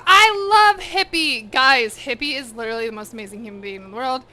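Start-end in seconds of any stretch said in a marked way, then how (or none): 0.1-1.5 gushing. Real, not acted. Gushing over streamer.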